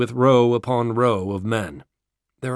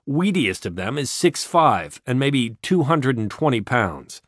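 ordinary delivery

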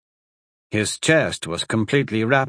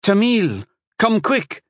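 calling out; 11 semitones higher, 4 dB louder, slower than usual. Acted. Calling husband from back room.

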